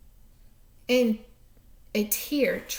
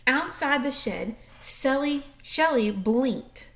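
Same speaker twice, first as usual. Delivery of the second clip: plain reading voice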